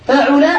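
فعل said fa-'u-la, middle vowel u; damma on ain. Shown as a demonstration. Teacher listing verb patterns, فعل as fa'ula. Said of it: "بضم العين".

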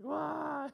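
a sudden, high, loud fake "Wah!" cry; mock toddler wail. Son finds his toy is lost, mock toddler wail. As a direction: squeal it like a small boy losing his toy. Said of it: "he does the whole crying thing".

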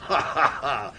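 a short merry laugh, ha-ha-ha; hearty laugh. Acted. Tipsy count laughing to his friend, merry laugh. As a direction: laugh it off lightly.

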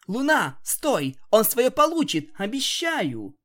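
loud pleading shout; urgent call. Acted. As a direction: call out loud to stop her. Calling to stop Luna.

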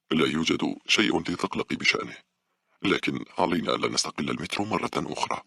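ordinary delivery, nothing marked

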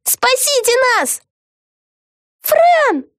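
very high, panicked yell. A narrator's reading. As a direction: yell for rescue in a squeal. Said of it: "заорала Вега". (панический крик)

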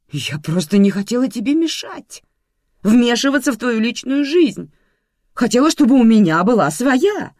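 loud, high, building protest; rising outburst of self-defence; impassioned exclamation. A narrator's reading. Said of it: "воскликнула Светлана Степановна".

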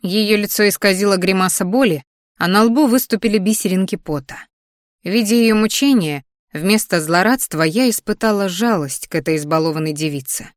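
plain reading voice

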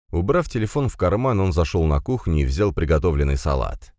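ordinary delivery

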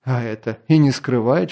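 ordinary delivery

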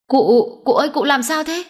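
high-pitched alarmed questioning; frightened cry. A narrator's reading. Servant finds his master lying hurt, frightened cry. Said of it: "Nó sợ hãi kêu lên".

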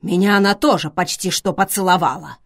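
defiant. A narrator's reading. Schweiger answers Vivian's jab about kissing, defiantly. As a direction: declare it as a challenge. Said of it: "Швайгер сказал с вызовом".

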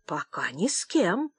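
quiet, slow defiance; insolent reply. A narrator's reading. Old man answering the shouting clerk, quietly insolent. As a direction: answer back softly, cheekily. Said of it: "дерзко ответил старик".

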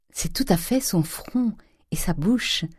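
high, slow, breathy murmur; whispery, tender wonder; hushed tenderness. A narrator's reading. Eugénie studying the portrait with her mother, hushed tenderness.

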